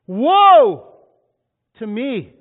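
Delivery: high loud anguished cry; crying out woe. Acted. Isaiah crying out before God, loud anguished wail. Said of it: "I cried".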